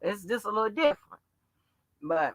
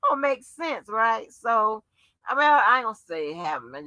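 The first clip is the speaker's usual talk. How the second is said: ordinary talk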